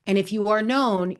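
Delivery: ordinary delivery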